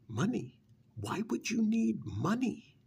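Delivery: high, rising disbelief; incredulous. Acted. Familiar dismissing a recruit's need for money, incredulous.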